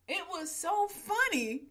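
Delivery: high, slow words through real laughter; barely holding in the giggles; laughing. Real, not acted. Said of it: "I was crying 'cause I was laughing".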